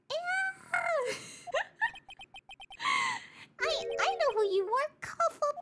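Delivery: squeaky high cartoon voice; high, cackling character.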